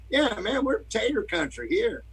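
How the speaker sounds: high, lively Southern country twang; mock Southern accent. Idaho guy boasting of tater country, mock Southern accent. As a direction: put on an exaggerated Southern accent. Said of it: "sound like he was from the South".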